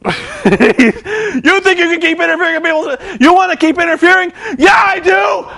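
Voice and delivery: mock the question and shout yes; Flair mock taunt. Flair mocking Hogan's promo, laughing shout. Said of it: "he goes".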